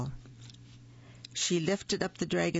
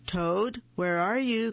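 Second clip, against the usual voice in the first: calling out; 5 semitones higher, 3 dB louder than usual. A narrator's reading. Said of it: "called Frog".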